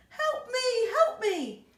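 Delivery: high, loud panicked cries; cry for help. Acted. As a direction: call out while blown away. Miss Tiny blown away.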